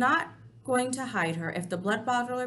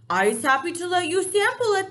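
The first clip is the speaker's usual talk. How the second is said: enthusiastic BFG; 9 semitones higher, 9 dB louder than usual. Acted. BFG coaxes.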